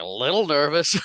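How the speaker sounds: high, loud mock disbelief; incredulous echo. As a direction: throw LITTLE back as a stunned question. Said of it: "He's like".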